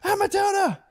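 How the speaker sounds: put-on very high, shrill excited kid squeals; kids shrieking. Dad imitating his kids squealing at the bunny.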